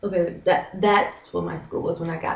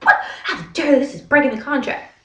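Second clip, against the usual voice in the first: dramatic indignation; 7 semitones higher, 5 dB louder than usual. Retold or imitated. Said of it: "I get really dramatic".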